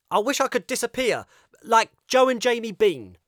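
put-on high, loud, fast childish cry; angry boy outburst. Kip lashing out at the parents in the car. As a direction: hurl the wish at them.

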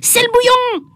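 high warning cry from a pupil; a boy crying out that the supervisor is coming; child's shout. A narrator's reading. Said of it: "il y en a un qui a crié".

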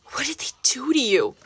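whispered, horrified; a whispered cry of dismay. Acted. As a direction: hush it out in shock over his father. Boy cradles his wounded father.

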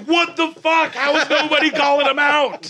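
high, loud incredulous outburst; outraged shout. Retold or imitated. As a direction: shout it in disbelief, pitch way up. Retold outrage at joke theft.

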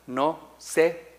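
"no se puede" arrastrado, lento; muy despacio. Shown as a demonstration. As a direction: estira cada palabra para que entiendan. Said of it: "si lo leo despacito". (lectura muy lenta y marcada)

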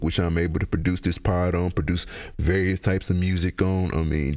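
ordinary delivery

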